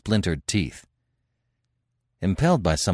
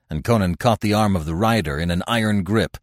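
ordinary delivery, nothing marked

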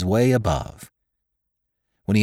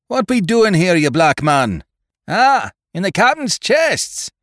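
much higher, louder harsh sailor's voice; angry sailor's shout; 10 semitones higher, 4 dB louder than usual. Acted. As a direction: hit them like a blow from above. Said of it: "like a blow, the voice of David Spry".

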